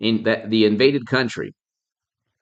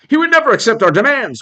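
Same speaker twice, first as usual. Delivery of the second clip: high, loud and fast mock whine; parroting opponents in a mock whiny voice; mocking excuse; 9 semitones higher, 4 dB louder, faster than usual. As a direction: sneer it as the other side's excuse.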